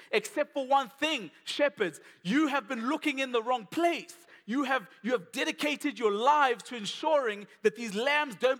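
high, loud, driving sermon delivery; impassioned preaching. Acted. Angel telling shepherds of the real sacrificial lamb, preached passionately. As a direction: preach it with rising force, hammering the key words.

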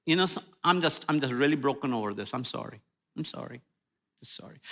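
fading to a soft, low, remorseful sorry; mock-sincere broken sorry; broken apology. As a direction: trail off in quiet regret. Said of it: "just say".

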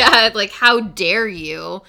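loud, slow mock indignation; mock outrage. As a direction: play up fake offence.

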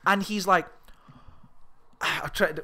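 ordinary delivery

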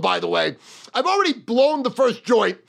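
ordinary talk

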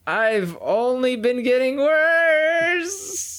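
high, very drawn-out final word; comic lament rising to a sustained high note; mock wail. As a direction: stretch it into a long, high, held cry. Comic wail about own decline.

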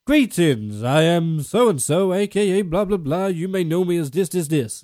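mock formal podcast intro; pompous intro. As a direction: introduce yourself like a self-important host. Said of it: "an intro saying where they're like".

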